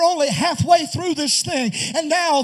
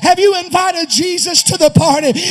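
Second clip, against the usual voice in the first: preaching at full force; 5 dB louder than usual. Real, not acted. Preacher calling the weary congregation, full force. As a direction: lift the house with the altar call. Sermon climax appeal.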